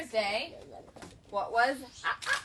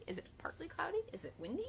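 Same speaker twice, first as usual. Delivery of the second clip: soft whispered question; whispering; 6 semitones higher, 10 dB quieter, faster than usual. Acted.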